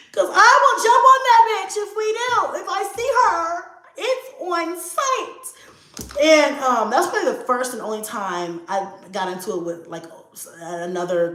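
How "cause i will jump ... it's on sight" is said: high-pitched mock-furious threat; retold threat. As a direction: warn them in a raised, high voice. Retold threat to jump girlfriend.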